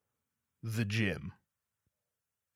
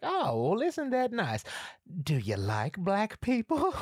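put-on much higher, slow sugary old lady; grandma asking; 10 semitones higher, 3 dB louder than usual. Grandma innocently asking the boy, high and slow. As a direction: ask it sweetly like a southern granny. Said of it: "She's like".

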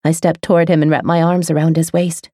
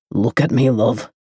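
low admiring male voice; 5 semitones lower than usual. A narrator's reading. Male lover speaks in story.

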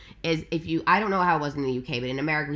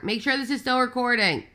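higher, raised voice to someone off-mic; calling out; 8 semitones higher than usual. Real, not acted. Guest calling to Bobby to keep recording, raised. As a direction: call the request across the room.

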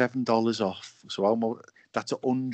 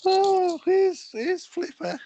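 excited high voice; 5 dB louder, slower than usual. Acted. Excited dolphin show voice.

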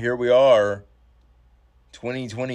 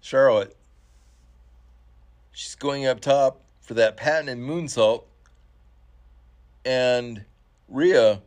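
live play-by-play building to shouts; wrestling commentator; 2 semitones higher, 5 dB louder than usual. Acted.

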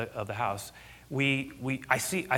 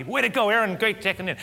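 hearty send-off; 9 semitones higher, 5 dB louder than usual. Retold or imitated. Reenacts hearty parting greeting.

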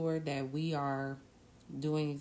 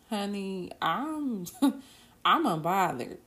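higher-pitched imitation broken by a laugh; amused quote; 4 semitones higher, 6 dB louder, slower than usual. Retelling the esthetician's reply after Election Day, amused and laughing. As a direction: quote him playfully, laughing through it.

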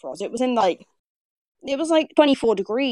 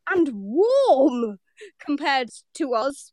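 higher, very drawn-out words; mock indignation; 3 semitones higher, much slower than usual. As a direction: stretch each word in hammed-up disbelief. Mock rant at complaining southerners.

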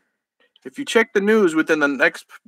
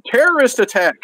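yelled; 7 semitones higher than usual. Acted. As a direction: raise the alarm. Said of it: "One yelled".

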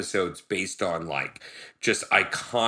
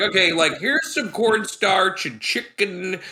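lightly voiced cook, higher; mock chef voice; 9 semitones higher than usual. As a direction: play a silly cook, higher. Voicing the cooks.

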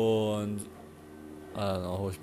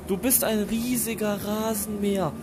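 loud and high over a lawnmower; shouting over noise; 9 semitones higher, 6 dB louder than usual. Real, not acted. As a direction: call out over a roaring mower.

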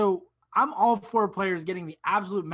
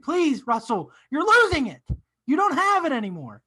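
higher and louder, pleading with Russell; mock exasperated plea; 8 semitones higher, 7 dB louder than usual. Podcaster as fan begging Westbrook to quit, loud mock plea. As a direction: beg him to stop, hammed-up.

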